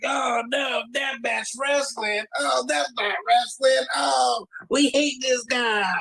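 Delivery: high, slow shouted fan whine; high drawn-out mock fan outrage; mock outraged fans. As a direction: ham up snobby fans hating deathmatch. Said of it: "all the fucking fans are like".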